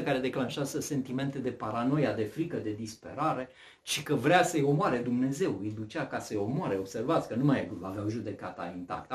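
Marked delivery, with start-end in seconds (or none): none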